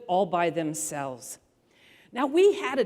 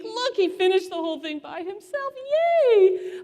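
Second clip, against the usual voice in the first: much higher, beaming "Yay" for the guests; proud cheering; 11 semitones higher than usual. Retold or imitated. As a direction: show off your kid to the ladies. Showing off son to ladies.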